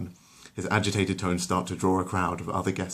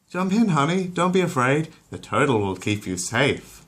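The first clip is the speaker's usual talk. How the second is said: higher, louder encouraging mother; coaxing mum voice; 7 semitones higher, 4 dB louder than usual. Acted. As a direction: coax the scared toddler in. Lois coaxes Stewie into pool.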